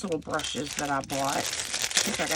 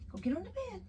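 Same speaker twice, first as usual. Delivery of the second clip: much higher, soft coaxing pet voice; coaxing the dog; 7 dB quieter, faster than usual. Real, not acted. Urging her sick dog onto the bed in high, soft pet talk.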